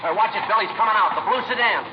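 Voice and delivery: loud, high urgent warning; warning shout. Acted. Friday warning Bill of the oncoming car, shouted. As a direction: yell to warn your partner.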